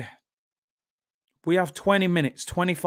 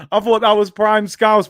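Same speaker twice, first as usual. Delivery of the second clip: louder, higher mock Scouse; Scouse accent; 6 semitones higher, 6 dB louder than usual. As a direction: put on Scouse to defend it. Jokingly defends his Scouse in Scouse.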